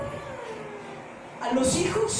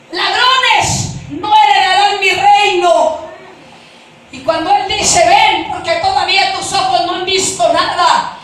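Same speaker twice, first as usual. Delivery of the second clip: loud, raised, driving testimony; full-force preaching; 5 semitones higher, 10 dB louder than usual. Real, not acted. Preacher warning that thieves won't inherit the kingdom, forceful preaching. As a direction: thunder the warning to the congregation.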